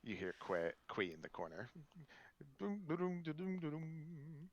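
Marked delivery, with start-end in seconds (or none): 2.6-4.5 imitated drumbeats. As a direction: drum sounds, high then fading. DM imitates drum sound.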